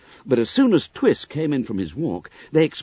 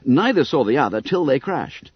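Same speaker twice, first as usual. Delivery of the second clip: ordinary delivery, nothing marked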